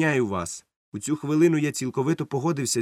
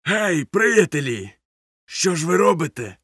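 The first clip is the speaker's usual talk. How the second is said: higher, drawn-out calling shout; shouted hail; 5 semitones higher, 3 dB louder than usual. A narrator's reading. Cook hails the sailors moving powder, shouting. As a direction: call out as if to mates across a deck. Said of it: "крикнув".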